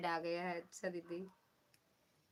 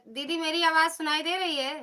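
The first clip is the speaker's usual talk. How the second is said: calling out; 7 semitones higher, 6 dB louder than usual. Real, not acted. Checking audio with sister live.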